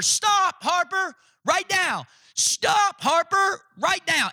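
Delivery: high, urgent mock shout of stop; wife screaming. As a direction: yell for the toddler to stop. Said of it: "my wife begins to scream at her".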